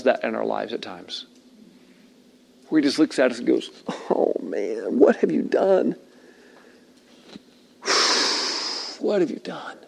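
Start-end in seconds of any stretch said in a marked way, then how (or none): 4.1-5.9 soft, sorrowful letdown; compassionate. Shown as a demonstration. Jesus looking at us in our lives, soft compassion. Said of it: "great compassion and mercy". 7.8-8.9 long, soft exhale; heavy sigh. Shown as a demonstration. Jesus looking at us, heavy compassionate sigh. 9.0-9.8 very soft, grieving question; compassionate. Shown as a demonstration. Jesus to us in his mercy, hushed compassion. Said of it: "great compassion and mercy".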